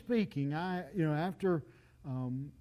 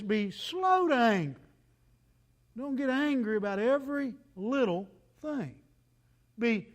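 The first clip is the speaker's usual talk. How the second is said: loud preaching, pounding each point about anger; full-force preaching; 4 semitones higher, 6 dB louder than usual. Acted.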